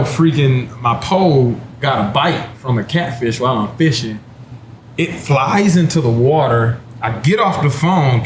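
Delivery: normal talking voice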